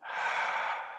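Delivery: soft sigh out through the mouth; long audible exhale. Shown as a demonstration. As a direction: let the breath go in one soft, airy sigh. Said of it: "then out from the mouth".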